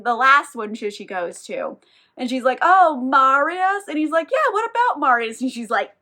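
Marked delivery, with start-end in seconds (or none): 2.6-3.8 drawn-out, sly, higher and louder; coy. Retold or imitated. Woman fishing a scholar for news, coyly. 4.3-5.4 much higher, eager reply; perked-up shopkeeper. Retold or imitated. Shopkeeper suddenly interested in the name, eager.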